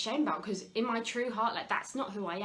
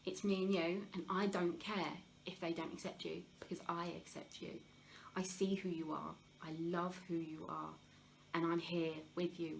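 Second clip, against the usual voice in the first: tender reassurance; 3 semitones lower, 6 dB quieter than usual. Retold or imitated. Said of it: "was just like".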